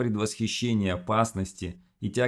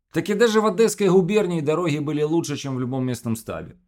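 contemptuous; 8 semitones higher, 5 dB louder than usual. Acted. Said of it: "сообщил философ с презрением в голосе".

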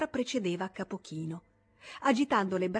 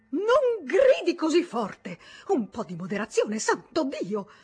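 shouting; 8 semitones higher, 8 dB louder than usual. Acted. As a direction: shout him down in a panic. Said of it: "si udiva gridare Grünlich". (grido acuto e forte, esasperato)

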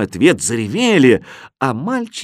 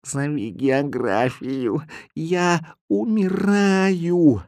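slow, strained plea climbing high; long-drawn feline death wail; dying cat; 5 semitones higher, 3 dB quieter, much slower than usual. A narrator's reading. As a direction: drag it out like an expiring cat. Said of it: "услышал голос Кузи".

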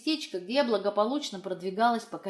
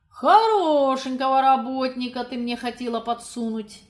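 mocking indignation; 3 semitones higher, 6 dB louder than usual. A narrator's reading. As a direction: scoff with amused indignation. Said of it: "насмешливо возмутился Максим".

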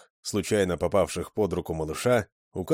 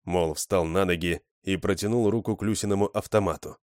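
usual speaking voice

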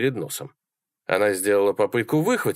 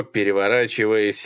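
ordinary delivery

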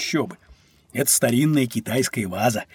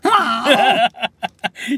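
hearty laugh; 9 semitones higher, 4 dB louder than usual. Acted. Host laughs at grumpy friend.

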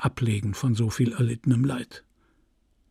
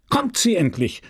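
raised, higher voice, mildly exclaimed; impatient cry; 6 semitones higher than usual. A narrator's reading. Mayor waits for the old woman to appear, mild impatient cry. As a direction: demand it with understated impatience. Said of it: "schrie Tuvache".

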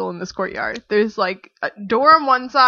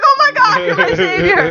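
higher, louder, laughing relief; relieved gush; 10 semitones higher, 5 dB louder than usual. Retold or imitated. Stranded traveller thanking the German tourist, gushing relief. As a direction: gush at your rescuer. Rescued by English speaker.

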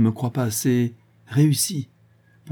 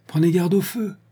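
higher call across the room; 9 semitones higher than usual. A narrator's reading. Monsieur de Gueldre warning Liane as she leaves, calling out. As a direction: call a warning after her. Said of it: "criait monsieur de Gueldre".

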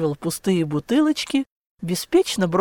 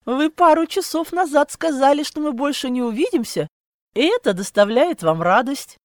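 mocking challenge; 6 semitones higher than usual. A narrator's reading. Heroine mockingly challenges Klaus's invitation.

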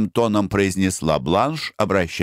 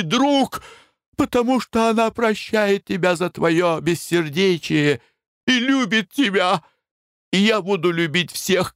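Foker, tearful; 13 semitones higher than usual. Acted. Пьяный влюблённый Фокер изливает чувства.

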